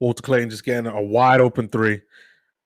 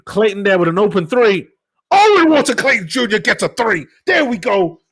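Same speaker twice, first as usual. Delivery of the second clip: building from brisk calling to a loud, higher shout at the made three; live play-by-play; 4 semitones higher, 5 dB louder than usual. Acted. Fan calling overtime for viewers, building to an excited shout on the three. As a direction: call the action as it happens, rising with excitement.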